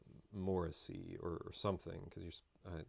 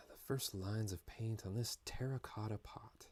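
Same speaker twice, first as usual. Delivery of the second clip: whisper; 6 dB quieter than usual. Real, not acted. Painting pot, whispered aside.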